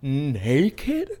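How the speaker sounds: high, slow, loud shocked disbelief; hammed-up outrage, drawing each word out; mock horror. Cohost reacts to a listener's mattress confession, scandalized. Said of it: "That was a Broadway performance".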